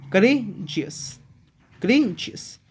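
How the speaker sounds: Courageous said slowly as करे, pause, Jesus; two-part break. Shown as a demonstration. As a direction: split courageous into two clear pieces. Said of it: "दो parts में मेरे को break करके".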